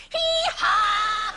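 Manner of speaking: a loud, high mock donkey hee-haw; donkey bray. Mules answering a comedian on the float with a loud bray. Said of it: "And I hear".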